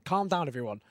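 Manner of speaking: ordinary talk, nothing marked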